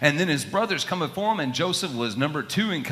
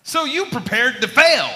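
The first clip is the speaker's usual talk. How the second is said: high, loud disbelieving question; incredulous; 10 semitones higher, 8 dB louder than usual. Acted. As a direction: call out his foolishness. Captain scolds the sailor.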